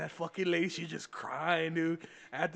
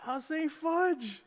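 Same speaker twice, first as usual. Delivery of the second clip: high, drawn-out mock innocence; indignant denial; 10 semitones higher, slower than usual. Student insisting to teachers he didn't swear, indignant denial. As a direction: plead innocence with a slow, high whine.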